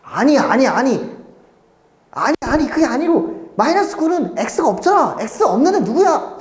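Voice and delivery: high-pitched retold teacher pleading; exasperated correcting. Teacher retells correcting confused pupils. (답답해서 높은 톤으로 다그치는 재연)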